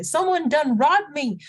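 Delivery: high, hammed-up Southern outrage; old-Western Southern accent. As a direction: wail it like a robbed townsperson in a Southern Western. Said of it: "a Southern, uh, like, a, an accent from, like, the old Westerns".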